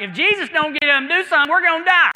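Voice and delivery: fast, high, loud panicked cry; the scared boys yelling in the storm; panicked disciples. Retold or imitated. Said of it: "They're like".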